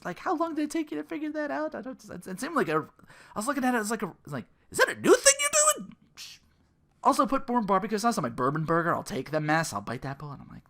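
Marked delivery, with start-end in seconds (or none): 4.7-5.9 mock amazed question. Mocking himself quoted at Wendy's.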